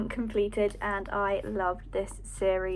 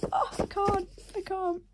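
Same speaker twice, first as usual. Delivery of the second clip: tearful; 9 semitones higher than usual. Real, not acted. Overwhelmed crying over book.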